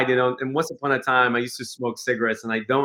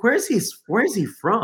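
puzzled wonder; 8 semitones higher than usual. Retold or imitated. Puzzling over the guest's accent.

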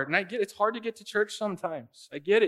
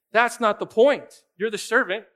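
forceful rebuttal; 3 semitones higher, 5 dB louder than usual. Real, not acted. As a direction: drive it home with real force. Preacher rebuts the imagined objection.

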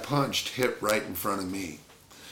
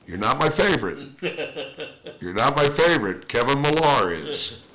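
higher and louder, a light teasing jab; teasing manager; 5 semitones higher, 7 dB louder than usual. Retold or imitated. Manager teasing his toughest player, lightly voiced louder jab. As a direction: needle him like a gruff old skipper. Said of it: "he always says this right afterwards".

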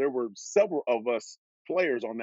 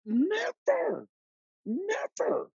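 much higher, insistent never; emphatic real denial, pitched high; much slower than usual. Real, not acted. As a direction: swear it never happened. Emphatic answer to host.

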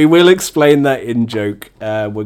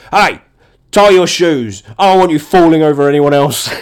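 louder, higher scolding; hammed-up irritated telling-off; passive aggressive; 4 semitones higher, 5 dB louder than usual. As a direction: snap it as an aggressive order. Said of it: "how I read it was".

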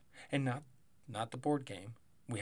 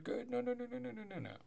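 rising high, fading softer; high alarmed no; 12 semitones higher, 4 dB quieter, faster than usual. Real, not acted. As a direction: squeal the nos in alarm. Alarmed repeated denial.

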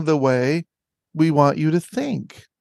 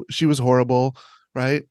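ordinary delivery

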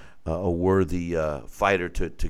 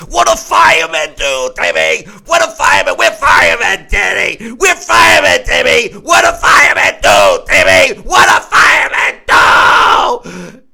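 screaming, far higher and louder corner yell; imitating Teddy Atlas; 13 semitones higher, 13 dB louder than usual. Trainer screaming at his fighter between rounds. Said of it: "so calmly and coolly and collectively".

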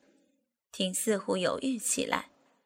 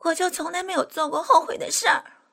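much higher, louder and fast; raised voice; 10 semitones higher, 6 dB louder, faster than usual. Acted. Proud woman loudly declaring she never regrets anything. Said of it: "扯高了嗓门".

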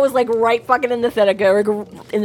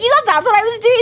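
indignant; 12 semitones higher, 3 dB louder, faster than usual. Retold or imitated. Murphy's indignant reply retold.

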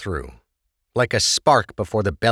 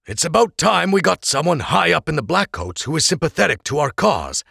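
explaining father; 9 semitones higher than usual. Acted. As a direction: make his friend understand. Oral explaining to Krebs.

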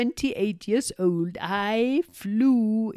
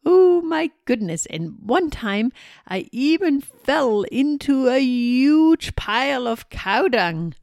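excited storytelling; 5 semitones higher, 7 dB louder than usual. Acted. Witch telling funny mishap.

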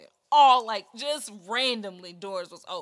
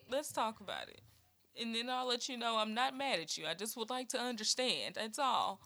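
much softer, calm and soothing; gentle reassurance; 11 dB quieter than usual. Shown as a demonstration. Auntie calming an upset child, soft and reassuring. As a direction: ease the child down softly. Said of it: "we gonna talk through this".

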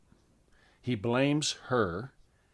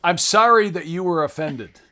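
fake apology; 4 semitones higher, 7 dB louder than usual. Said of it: "Here's their apology".

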